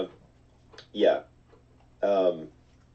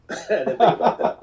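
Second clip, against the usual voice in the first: laughing aside; 8 semitones higher, 4 dB louder than usual. Real, not acted. Realising his Easter joke.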